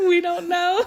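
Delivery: laughing through the words. Real, not acted. Host admits ignorance to the viewers, laughing. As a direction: say it while breaking into real giggles.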